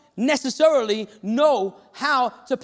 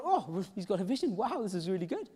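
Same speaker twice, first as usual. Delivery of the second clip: hushed, lower excited thought with a laugh; a little excited; 4 semitones lower, 10 dB quieter than usual. Retold or imitated. Father privately thrilled at his son's vision, quietly excited. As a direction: marvel softly to yourself, quietly amused. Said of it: "I got a little excited".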